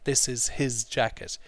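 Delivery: normal talking voice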